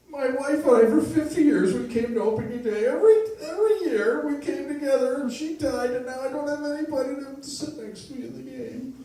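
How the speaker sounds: high, loud, tearful wail; old man sobbing to the young woman at the ballpark, mock tearful; hammed-up sobbing old man. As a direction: blubber it out like a heartbroken widower. Old man sobs about late wife.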